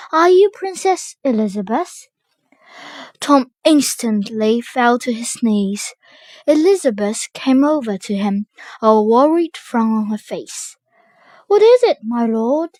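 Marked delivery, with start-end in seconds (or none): 0.1-2.1 astonished. Acted. Tom amazed to meet princess. 11.5-12.7 put-on worried girl voice. Worried princess asks.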